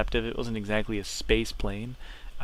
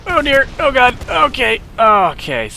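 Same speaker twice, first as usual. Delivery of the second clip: shocked exclamation; 12 semitones higher, 15 dB louder than usual. Real, not acted. Just crashed the plane.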